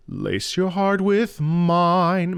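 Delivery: slow sung line, weight on "mine"; stress on mine. Shown as a demonstration. Vocal coach puts the emphasis on "mine". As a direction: lean into "mine" at the phrase end. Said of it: "Mine is the key".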